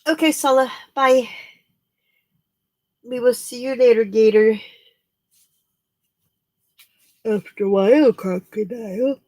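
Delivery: high, playful sign-off chant; singsong goodbye. Acted. Waving off a leaving viewer in a high singsong. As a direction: sing the see-you-later rhyme to them.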